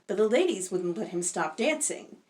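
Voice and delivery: ordinary delivery, nothing marked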